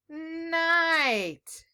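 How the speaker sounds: "night" in one piece with long n and stretched i; blended word. Shown as a demonstration. Teacher helping children decode a rhyming word, blended word. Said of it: "It rhymes with light".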